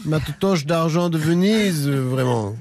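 plus aigu et lent, pleurnichard; vieux cinéaste geignard. Acted. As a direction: geins en traînant les mots. Suite de la parodie, voix aiguë. (le cinéaste se lamente, voix aiguë et lente)

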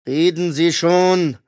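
hint of a stern interrogator; stern order. A narrator's reading. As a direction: force him to talk. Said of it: "befahl er".

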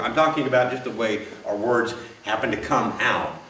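ordinary delivery

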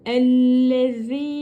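Ellezine slow with a lisped Z. Shown as a demonstration. Teacher showing ellezine slowly. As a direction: draw ellezine out, tongue on teeth for Z. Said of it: "Z peltekli Z".

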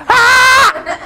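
very high loud scream, held; imitated toddler scream. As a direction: keep wailing like a hurt toddler. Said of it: "My brother's in the middle going".

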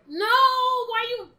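high, loud, drawn-out wail; exasperated protest. Real, not acted. Protesting at game enemies.

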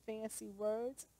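ordinary delivery

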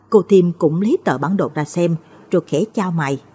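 ordinary delivery

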